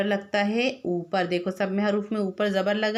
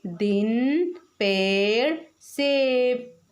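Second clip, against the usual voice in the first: very slow word sounding; 4 semitones higher, much slower than usual. Shown as a demonstration. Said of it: "जैसे".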